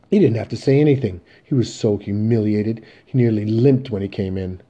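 loud, emphatic rebuke; angry reproach. Acted. Mother telling son how humiliated his father was, angry reproach. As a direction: drive the blame home loudly.

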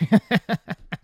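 high burst of laughter; laughing at own joke. Real, not acted. Comic laughing at his own punchline, high burst. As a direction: crack up at your own line.

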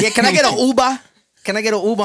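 hammed-up Massachusetts accent; Boston accent. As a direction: say Uber like a Bostonian, "ubə". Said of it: "Massachusetts people saying Uber".

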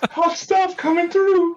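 high, slow, sing-song campy announcement; imitating a flamboyant Simpsons character, high and drawn out; Simpsons steel worker. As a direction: ham up the Simpsons steel mill line. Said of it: "It's like".

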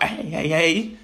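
ordinary talk

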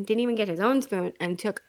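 usual speaking voice

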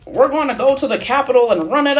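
mock rally leader urging the crowd on; mock Donald Trump Junior. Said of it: "becoming Donald Trump Junior".

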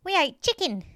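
put-on high, loud reminiscing old lady; June. A narrator's reading. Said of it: "She smiled her toothless smile".

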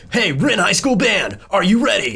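high, loud announcer shout, drawn out; Hot Wheels voice. Retold or imitated. Band member introducing the marching band, hyped announcer shout. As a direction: pump up a crowd like a hype announcer. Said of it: "use the Hot Wheels voice".

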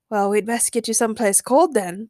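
ordinary delivery

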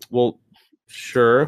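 normal talking voice

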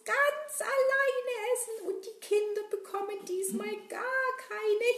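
high gleeful villain voice; nasty greedy glee. Acted. Villain gloating over stolen eggs.

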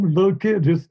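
ordinary talk, nothing marked

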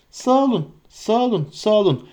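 high, relieved thanks; grateful thanks. Acted. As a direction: thank him one after another. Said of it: "dedi hobbitler birbirleri ardı sıra".

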